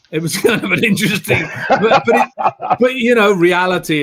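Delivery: amused chuckle breaking into the talk; can't keep a straight face telling it; laughing. Real, not acted. Laughing over the Highlander casting.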